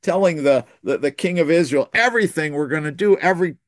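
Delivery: high, agitated demanding king; hollering king. Retold or imitated. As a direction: shout at the generals, suspicious and upset. He's hollering at his generals.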